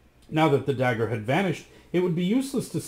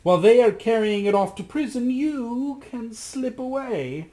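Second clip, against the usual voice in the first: crafty magician; 10 semitones higher, 4 dB louder than usual. Acted. The magician slyly asks Rasmus for his reflection. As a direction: demand it smoothly, like a scheming sorcerer.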